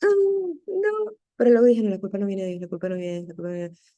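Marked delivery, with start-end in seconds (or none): 0.0-1.1 high, drawn-out mock wail; re-enacted "oh no" of dismay; dismayed groan. Speaker reacting to news of a guest, dismayed. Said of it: "yo me sentí terrible". 1.8-3.9 low repeated mantra, fading away; self-reassuring murmur. Retold or imitated. Speaker reminding herself guilt isn't from God, murmured mantra.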